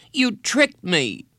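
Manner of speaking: put-on very high, stretched child's accusation; angry accusation. A narrator's reading. Boy stands and accuses his counselor, angry. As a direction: fling it out high and wounded.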